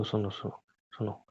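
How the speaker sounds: quiet, placating repetition; coaxing. Real, not acted. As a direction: drop the voice to calm a partner. Coaxing upset partner to listen.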